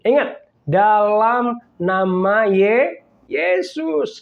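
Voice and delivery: slow build, Yesus lifted high; emphasis on Yesus. Real, not acted. Pastor stressing the name Yesus, slow. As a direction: stretch it and lift Yesus. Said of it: "emphatic drawn-out name".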